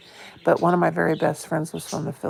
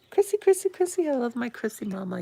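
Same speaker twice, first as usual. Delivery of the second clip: high happy squeal greeting a friend; delighted greeting; 6 semitones higher, faster than usual. Real, not acted. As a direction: sing out a friend's name with joy. Greeting a beloved viewer.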